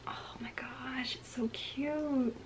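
slow, hushed cooing; adoring whisper. Real, not acted. Collector admiring her cute pin, adoring whisper. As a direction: breathe it out slowly, smitten.

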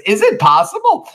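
very high, loud squeak of shock; outraged disbelief. Acted. Cassius stunned by Brutus's insult, high mock shriek. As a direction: shriek it in hammed-up indignation.

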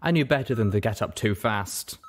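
plain reading voice

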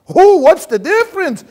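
loud, high cry of what's the difference; shouted question. Retold or imitated. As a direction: belt it out like a baffled heckler. Shouted punchline of the joke.

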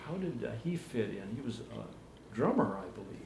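soft, hesitant question trailing away; too quiet to catch. Real, not acted. Interviewer asking about the brother, softly and hesitantly. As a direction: ask it softly, fading on the last words. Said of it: "What's that?".

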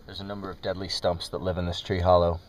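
low, hushed wildlife host; hushed documentary narration. Pretend. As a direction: narrate like a nature show in hushed tones. Mock nature documentary host.

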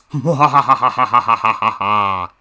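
long hammed-up villain cackle; gloating ha-ha-ha; evil villain laugh.

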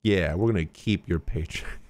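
ordinary talk

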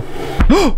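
sharp high gasp; startled gasp. Real, not acted. Streamer startled by something in the game, sharp gasp. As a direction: suck in air in fright.

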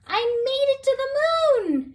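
high, loud cry of triumph; a small moth's high, loud whoop of joy; excited cry. Acted. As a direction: cry out in thrilled delight. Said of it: "she cried".

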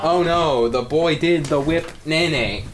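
hammed-up dismay at a dance; theatrical cry of despair; a kid fake-horrified by the whip; mock horror. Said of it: "I would scream and fall on the ground".

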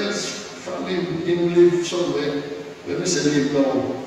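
ordinary talk

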